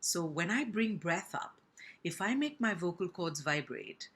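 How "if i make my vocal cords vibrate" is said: usual speaking voice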